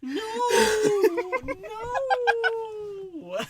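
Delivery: high, loud laughing disbelief; shrieking with laughter. Real, not acted. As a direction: crack up in horror. Hearing the eye-wipe part.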